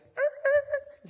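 high, loud screech to a halt; startled halt noise. Retold or imitated. Guards stopped just before whipping Paul, high startled screeching noise. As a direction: squeal "er, er, er" like sudden brakes.

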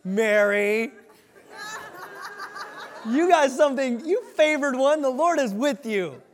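high, loud and laughing; gushing angel bursting with news; excited Gabriel. Retold or imitated. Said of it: "I see Gabriel just going".

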